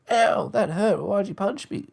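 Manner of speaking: put-on high whiny yelp of a kid; mock hurt. Punched schoolkid complaining, high mock whine. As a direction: play the punched kid, hammed-up. Acted victim after punch.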